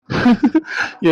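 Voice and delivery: high, short chuckle; amused chuckle. Acted. Brother laughs at his chatterbox little sister, amused chuckle. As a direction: chuckle fondly at a kid sister.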